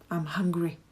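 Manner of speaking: ordinary delivery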